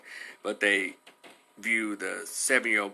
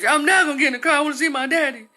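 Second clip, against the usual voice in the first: high, loud defiance; imitated child yelling in refusal; screaming child; 9 dB louder, faster than usual. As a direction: shout back like an upset little girl. Child's shouted refusal to cop.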